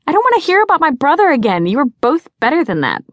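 high, loud, rapid telling-off; indignant scolding. A narrator's reading. Sister scolding the back seat about her brother, indignant. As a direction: scold your friends in a rush.

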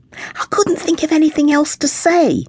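a mother caught out, protesting; defensive mum. A narrator's reading. Mother answers her child, high and defensive.

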